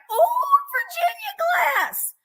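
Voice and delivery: put-on high, slow old prospector voice. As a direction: drawl it out like an old gold miner. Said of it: "I get into the old prospector voice".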